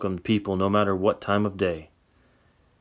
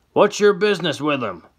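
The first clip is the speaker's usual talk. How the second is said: put-on higher, louder old man voice; suspicious old man; 8 semitones higher, 5 dB louder than usual. Old man questions Sam's business, higher and louder. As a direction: demand it like a wary old codger.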